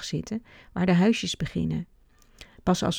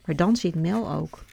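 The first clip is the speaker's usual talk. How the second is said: plain reading voice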